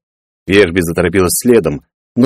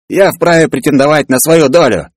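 higher-pitched, indignant character voice; indignant claimant; 8 semitones higher than usual. Acted. Peller bursts from the hold demanding his share, indignant. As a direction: stake your claim indignantly.

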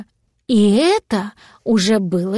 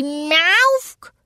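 high, drawn-out cat yowl; heartrending meow; 6 semitones higher than usual. A narrator's reading. Cat who cannot find his dragon, heartrending meow. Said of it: "издал душераздирающий".

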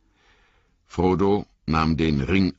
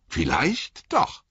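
high, slow, hopeful repeat; hopeful Sam; 8 semitones higher, slower than usual. Acted. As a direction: lift it into a wish.

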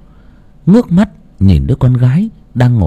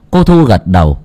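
ordinary delivery

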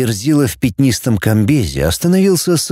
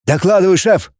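playful mock report, pitched high; clowning; 7 semitones higher than usual. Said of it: "дурачись козырнул бледнолицый". (дурашливо, высоким голосом)

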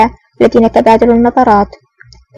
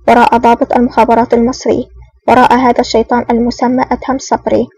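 usual speaking voice

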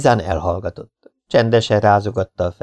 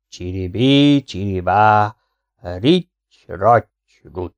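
rhythmic spell, dropping off at the end; magic incantation; much slower than usual. Acted. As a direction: chant each magic word apart, the last one falling away. Charmer recites a magic spell.

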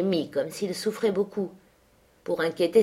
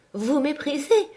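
startled, higher-pitched question; surprised question; 8 semitones higher than usual. A narrator's reading. Girl questioning her sickly cousin, surprised. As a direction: ask it taken aback, pitched up.

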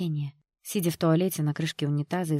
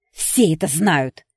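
loud, slow, spiteful retort; venomous, drawn-out outburst; spat out; 9 dB louder, slower than usual. A narrator's reading. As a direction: spit the words at her. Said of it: "выплюнула Кэти".

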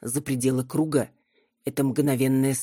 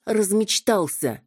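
snapping; 6 semitones higher, slower than usual. Acted. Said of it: "огрызнулась я".

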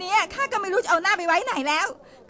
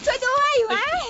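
screaming for help; 8 semitones higher than usual. Acted. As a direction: yell for help as he grabs you.

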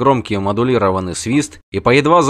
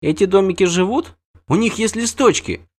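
put-on much higher child's voice in wonder; amazed little girl; 9 semitones higher than usual. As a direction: ask it wide-eyed like a small child. Ciri wondering.

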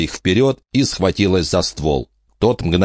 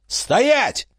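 shout. Acted. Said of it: "Эмма кинулась за ним".

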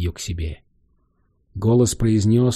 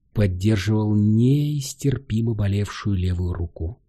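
ordinary delivery, nothing marked

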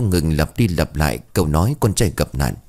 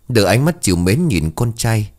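plain reading voice

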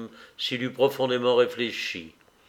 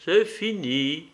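slow, higher, trailing finality; dying resignation; 4 semitones higher, slower than usual. A narrator's reading. Dying man concluding to his brother, slow resignation. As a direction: draw out the last words feebly.

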